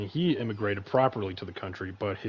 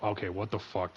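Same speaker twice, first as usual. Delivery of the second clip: ordinary delivery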